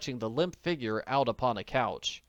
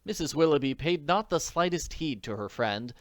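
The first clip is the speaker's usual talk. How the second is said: ordinary delivery, nothing marked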